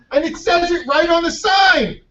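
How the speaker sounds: high-pitched, incredulous ranting; acted-out exasperation; exasperated mock shout. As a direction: point out the obvious with voice way up. Old man mocks the clueless runner.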